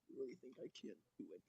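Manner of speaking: very quiet, fast, low villain voice; put-on deep voice, barely audible. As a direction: drop low and murmur it quickly. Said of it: "I did a deep voice".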